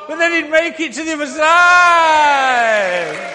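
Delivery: soaring victorious announcement; triumphant shout. A narrator's reading. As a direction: belt it out like crossing the finish line. Triumphant finish of tightrope act.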